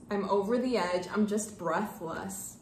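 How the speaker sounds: normal talking voice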